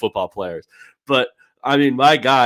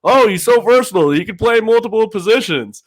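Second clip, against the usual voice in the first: high, sing-song mock of trendy praise; mocking pundit voice; 8 semitones higher, 6 dB louder than usual. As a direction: parody the cliché, lifted and loud. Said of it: "people like saying this type of stuff".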